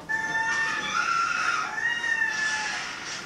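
long high gasp; high frightened gasp; terrified gasp. Real, not acted. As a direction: suck in air at the scare. Scare on screen.

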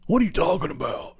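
high, put-on whiny what are you talking about; mock indignant crackhead. Imitating the crackhead brother snapping back in the kitchen. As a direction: squeak it out like an offended addict.

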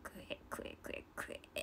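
breathy whispered chant of kue. Pretend. Whispering the call softly to fans. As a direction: hush it right down to a whisper.

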